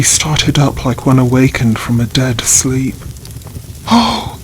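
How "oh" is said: a high, sudden gasped oh; startled exclamation. A narrator's reading. Sister waking from a trance, high startled cry. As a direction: jolt awake with a high cry. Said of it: "she exclaimed".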